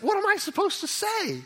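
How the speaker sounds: very high-pitched, hammed-up exasperation; flustered protest. Preacher acts Isaiah answering the voice, flustered protest. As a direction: throw up a high, helpless protest.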